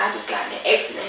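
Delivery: low rushed whisper into the phone; hushed. Retold or imitated. Repeats it hushed to boss.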